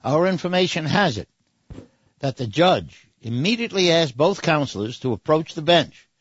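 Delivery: normal talking voice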